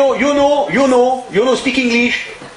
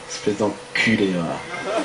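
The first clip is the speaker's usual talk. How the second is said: much softer, slow, under the breath; muttered insult; 12 semitones lower, 8 dB quieter, much slower than usual. Acted. Owner insulting departing regular behind his back, muttered low. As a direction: spit the insult once he's gone.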